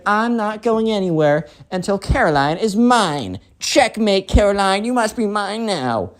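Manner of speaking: high, theatrical declaration; defiant spoiled kid. Acted. Spoiled kid refuses to leave without Caroline, defiant. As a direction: proclaim it in a high, bratty voice.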